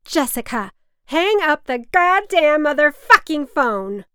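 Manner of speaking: mother screaming from her bed at Jessica on the phone. Acted. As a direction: scream across the apartment in rage. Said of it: "my mother was screaming".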